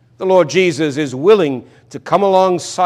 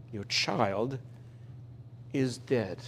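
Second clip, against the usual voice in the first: soft, grave delivery of the news; a low hush over the word dead; hushed news of death; 5 semitones lower, 17 dB quieter, slower than usual. Acted. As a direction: let it land quietly, like bad news.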